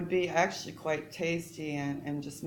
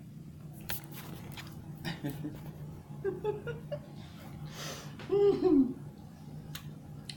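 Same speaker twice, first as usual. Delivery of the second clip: silent giggle. Real, not acted. Embarrassed about food in teeth.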